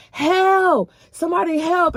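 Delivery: high, loud yell for help; retold scream for help. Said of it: "I'm screaming from the top of my lungs".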